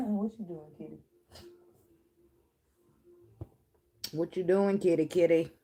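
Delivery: talking sweetly to her cat; cooing to the cat. Real, not acted. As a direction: coax the kitty in a pet voice.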